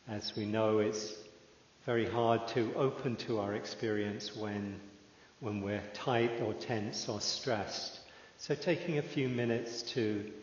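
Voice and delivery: calm, unhurried meditation guide with long pauses; measured, settled voice leaving room to breathe; guided meditation. Real, not acted. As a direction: ease listeners into stillness, phrase by phrase.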